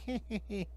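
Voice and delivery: soft wicked snicker; a quiet villain's laugh; evil chuckle. Acted. Villain laughs after scheming.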